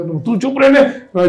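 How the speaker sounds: high, slow, exasperated scolding; irritated mother. Retold or imitated. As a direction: shut the child up, fed up. Stressed mother snaps at child. (થાકેલી માનો ચિડાયેલો અવાજ)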